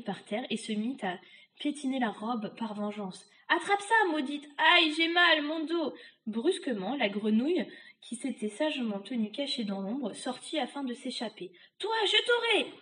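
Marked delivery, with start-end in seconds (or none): 3.5-5.9 high witch's curse turning to yelp; curse, then cry out in pain; vengeful, aching witch. Acted. Witch stamping in revenge, high cry as her back hurts. 11.8-12.7 high-pitched witch's howl; howled threat. Acted. Witch to the escaping frog, high howled threat. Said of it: "hurla la sorcière".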